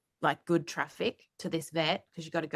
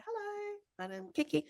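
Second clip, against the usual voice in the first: high, much softer dog voice; friendly dog voice; 13 semitones higher, 9 dB quieter than usual. Acted. Dog walking into the vet's daily, high soft friendly voice. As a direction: introduce yourself as a dog, tiny and high.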